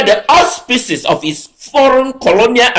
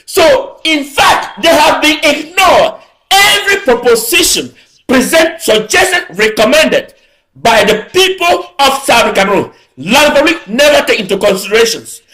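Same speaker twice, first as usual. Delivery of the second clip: raised, higher, declaiming to the crowd; impassioned political oratory, pitched up; fiery rally speech; 5 semitones higher than usual. Real, not acted. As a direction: rouse the crowd, hammering each phrase. Rally speech at full force.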